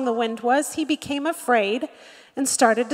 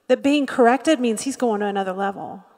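normal talking voice

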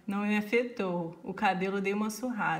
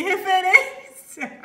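laughing; 8 semitones higher, 10 dB louder, much slower than usual. Retold or imitated. Reading friend's message laughing.

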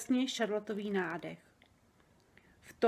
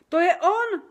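much higher and louder, shocked; alarmed cry; 9 semitones higher, 10 dB louder than usual. A narrator's reading. Sofie recognises the nightmare, high loud alarmed cry. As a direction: shout it out in alarm. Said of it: "vykřikla".

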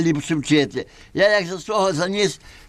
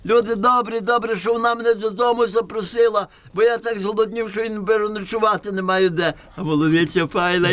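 high, sing-song peddler voice; 5 semitones higher than usual. Acted. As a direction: hawk the goat like a trickster.

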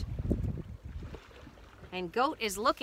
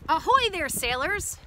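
high, louder sailor's hail; hailing cry; 6 semitones higher, 4 dB louder than usual. A narrator's reading. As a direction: hail the crew from shore. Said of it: "comes a cry".

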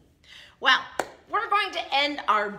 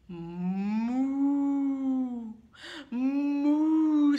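long drawn-out moos; cow mooing; 4 semitones higher, 4 dB louder than usual. Acted. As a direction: moo slowly like a cow. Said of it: "and a cow says".

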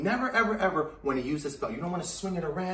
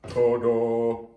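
dad voice; 5 semitones lower, 5 dB louder than usual. Shown as a demonstration. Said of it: "you sound like my dad".

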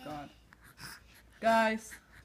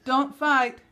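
louder, firm don't fight; stern scold; 2 semitones higher, 9 dB louder, much slower than usual. Real, not acted. Mom sternly ordering her kids not to fight.

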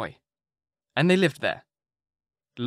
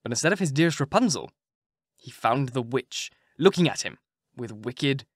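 ordinary delivery, nothing marked

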